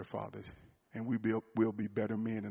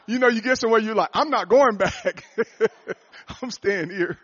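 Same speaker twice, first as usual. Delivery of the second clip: higher, louder laughing declaration; 10 semitones higher, 13 dB louder than usual. Real, not acted. Pastor laughing to the men that he won't leave, laughing. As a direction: laugh while refusing to go home. Laughing about not leaving.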